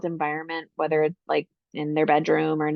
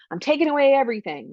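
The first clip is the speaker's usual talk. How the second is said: fast, higher snapped parent threat; mock punitive parent; 7 semitones higher, 3 dB louder, faster than usual. Shown as a demonstration. Parent announcing a punishment to a tardy daughter, mock harsh. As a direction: rattle it off like a strict parent. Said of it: "instead of saying".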